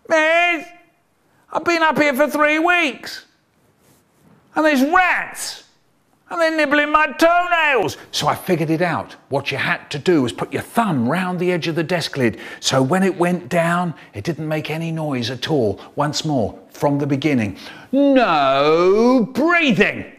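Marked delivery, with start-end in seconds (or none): 0.1-8.0 put-on high plaintive child; whiny trapped kid. Child stuck in school prison. 17.9-20.0 slow loud drawn-out command; teacher ordering the class not to breathe; stern teacher. Retold or imitated.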